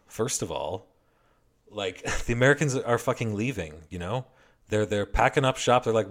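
ordinary talk, nothing marked

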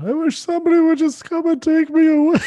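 mocking whiny Elliot. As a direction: ham up a desperate plea to be rescued. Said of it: "Elliot going".

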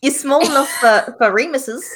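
put-on patronizing accented helper; talking to a little kid. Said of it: "to talk to a little kid".

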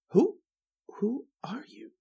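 hushed, high-pitched stammered question; startled demand. Acted. Writer reading his hero facing an intruder, startled demand. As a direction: stammer it high and under the breath. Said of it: "demanded Devereaux".

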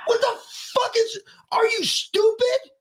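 high-pitched, exasperated shouting; angry berating. Real, not acted. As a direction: berate them in disbelief, pitch right up. Berating listeners for trusting government.

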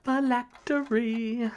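high, slow, drawn-out answer; teasingly slow, high-pitched delivery; playful answer. Real, not acted. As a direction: stretch each syllable up high. Playfully giving a quiz answer.

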